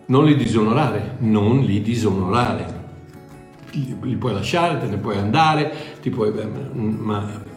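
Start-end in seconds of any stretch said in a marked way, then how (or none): none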